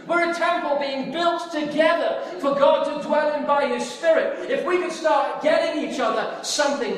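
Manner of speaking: impassioned sermon delivery; full-force preaching. Acted. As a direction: preach it with rising fervour.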